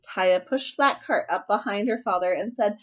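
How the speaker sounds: usual speaking voice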